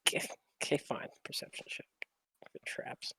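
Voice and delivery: grudging whisper. Real, not acted. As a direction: mutter it under your breath. Whispered grudging perception check.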